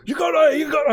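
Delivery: high, loud delighted cheer; excited. Real, not acted. Player celebrating the stolen purse. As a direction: celebrate like you just won.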